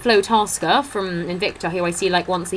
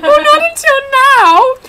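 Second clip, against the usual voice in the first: much higher, louder quick comeback; high retort; 9 dB louder than usual. Retold or imitated. Answering the comedian's jab, high and loud. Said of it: "And I said".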